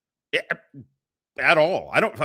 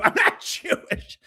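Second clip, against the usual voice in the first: mock shout; 14 semitones higher than usual. Mocking shout of denial.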